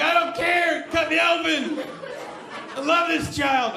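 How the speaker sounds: loud, high, overwrought demand; hammed-up hysterical sister; dramatic sister. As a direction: beg to be cut open like a martyr. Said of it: "She's like".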